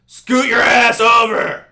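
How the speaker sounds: loud, high, drawn-out mock yell; cranky old man. Cranky old guy in the steam room, mock yelling. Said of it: "he's just an old, cranky".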